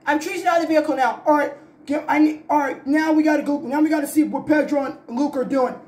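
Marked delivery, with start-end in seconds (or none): none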